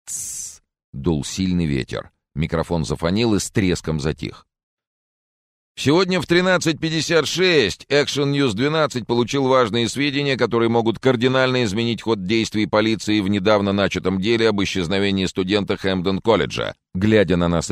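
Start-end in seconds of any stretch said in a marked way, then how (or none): 0.1-0.6 soft voiceless "tsss"; sharp shush. Acted. Friend hushes the others at the TV, soft voiceless shush. Hushing friends watching the news. 5.8-16.7 high-pitched, emphatic news report; TV field reporter. Acted. Reporter announces important news to viewers, high announcing voice.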